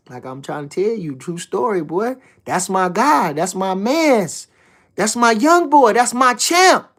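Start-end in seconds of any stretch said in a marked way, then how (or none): none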